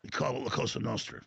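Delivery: put-on low New York mobster accent. Host playing Brooklyn mobster hinting at business, New York accent. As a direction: confide it low, like a Sopranos gangster. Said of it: "part of the Sopranos".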